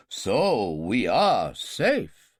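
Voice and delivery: very slow, mock-grave king; mock seriousness. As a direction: feign gravity, slowly. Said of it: "In mock seriousness".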